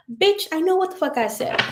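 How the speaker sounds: high-pitched indignant comeback; sassy mock retort. Answering her brother's "you said", sassy and indignant. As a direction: fire back in mock outrage. Snapping back at quoted brother.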